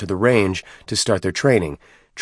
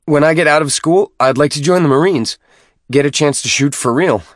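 higher, louder eager voice; gathering courage; 7 semitones higher, 5 dB louder than usual. Acted. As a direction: blurt out a hopeful wish. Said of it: "worked up the courage to speak freely".

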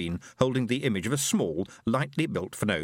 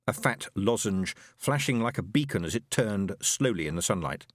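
usual speaking voice

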